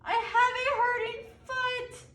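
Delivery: loud, high whine; whining child. Acted. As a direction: complain like a pouting toddler on the ground. Child complains lying on sidewalk.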